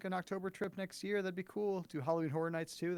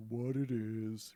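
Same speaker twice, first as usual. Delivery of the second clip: Donkey; 4 semitones lower, much slower than usual. Retold or imitated. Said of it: "Donkey comes in and says".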